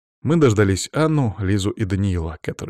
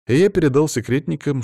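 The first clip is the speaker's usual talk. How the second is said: ordinary delivery, nothing marked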